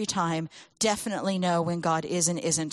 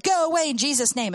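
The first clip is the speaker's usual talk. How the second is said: firm, raised, higher command to the scary things; bold command; 7 semitones higher, 5 dB louder than usual. Retold or imitated. Child in his dream ordering scary things away, firm raised command. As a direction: order them off with bold faith.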